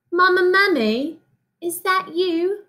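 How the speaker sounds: put-on high, slow British child calling out; British accent. Lost baby mummy calls for his mother in a British accent. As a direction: call for mama in a small British voice. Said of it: "read it in a British accent whenever the little mummy talks".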